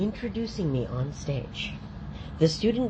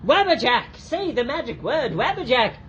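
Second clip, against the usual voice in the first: put-on kids party magician; 6 semitones higher than usual. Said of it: "And you're like".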